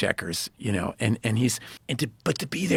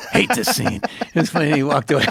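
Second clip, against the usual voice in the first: high, louder, talking through laughter; laughing; 9 semitones higher, 6 dB louder than usual. Real, not acted. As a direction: crack up while telling the story. Laughing at own retold quote.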